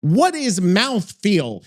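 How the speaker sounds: ordinary talk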